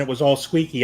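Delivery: normal talking voice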